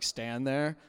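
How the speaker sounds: ordinary talk, nothing marked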